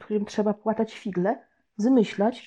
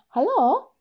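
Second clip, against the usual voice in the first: put-on high, louder eager boy picking up the phone; eager hello; 9 semitones higher, 5 dB louder than usual. Boy answers the phone, eager to prank, with a high bright hello. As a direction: answer hoping to fool someone. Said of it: "Ucieszyłem się i powiedziałem".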